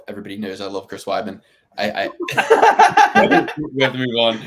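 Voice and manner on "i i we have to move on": slow, laughing through the words; can't stop laughing. Real, not acted. Host laughing as he tries to move on.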